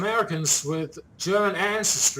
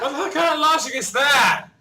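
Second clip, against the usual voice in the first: shouting in disbelief, higher and louder; incredulous shout; 9 semitones higher, 6 dB louder than usual. Real, not acted. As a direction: yell it like you can't believe it. Baffled by the Hitler comparison.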